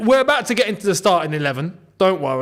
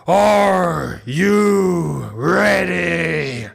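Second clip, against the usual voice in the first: long drawn-out wrestler's roar; Triple H; 2 semitones lower, much slower than usual. Acted. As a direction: bellow it like a ring entrance, stretching every word. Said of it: "that was a good Triple H impression".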